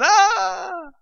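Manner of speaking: long, high held scream at modest volume; sustained high-pitched "ah" of fright, acted; mock scream. Preacher imitates being thrown in the fire, mock screaming. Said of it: "a place where you might scream".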